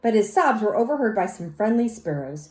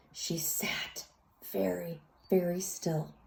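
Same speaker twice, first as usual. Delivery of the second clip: whisper; 4 semitones lower, 12 dB quieter, slower than usual. A narrator's reading. Cat sitting very still.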